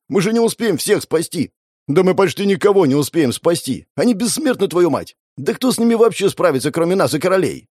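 high, urgent, agitated protest; panicked arguing. Acted. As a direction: argue in alarm over the din. Panicked argument amid battle noise.

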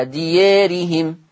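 ديارهم with alif tilted toward e, slow; taqlil reading. Shown as a demonstration. Teacher models taqlil on ديارهم, slow. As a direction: recite ديارهم slowly with reduced alif. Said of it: "برضو التقليل".

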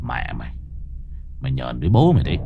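low, grinding, furious curse; through gritted teeth. Acted. Exorcist cursing the stubborn ghost, low and furious. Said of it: "nghiến răng nghiến lợi".

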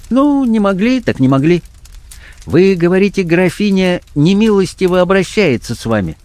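high-pitched, patronising blackmailer; blackmailer character voice. Acted. Blackmailer urging a trembling woman to business, patronisingly. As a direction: coax the visitor in the blackmailer's high voice.

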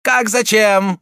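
high, loud angry prelate; angry exclamation. A narrator's reading. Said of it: "воскликнул гневно прелат".

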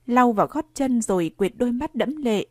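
plain reading voice

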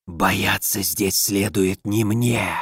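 low, slow, soft otherworldly rasp; inhuman rustling voice. Acted. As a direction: rustle it like a dead thing speaking. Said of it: "снова зашелестел нечеловеческий голос".

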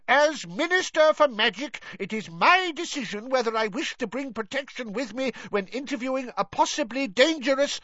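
high-pitched, self-important outrage; Fudge's angry bluster. A narrator's reading. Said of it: "roared Fudge".

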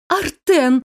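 high, loud pleading name; desperate call. A narrator's reading. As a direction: cry out to him. Caroline pleads.

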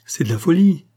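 high, loud cry of protest; exclaiming. A narrator's reading. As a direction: cry out that this is madness. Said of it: "s'écrie Maurice".

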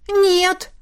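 high, sharp "Нет!"; a hammed-up frightened no; alarmed cry. A narrator's reading. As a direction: blurt it out in panic. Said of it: "хором воскликнули".

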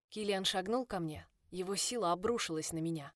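plain reading voice

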